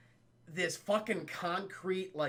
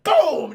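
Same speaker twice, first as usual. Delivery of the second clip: loud boom sound; 9 semitones higher, 14 dB louder than usual. Retold or imitated. Water blast sound effect.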